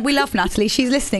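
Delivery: ordinary talk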